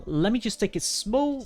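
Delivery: normal talking voice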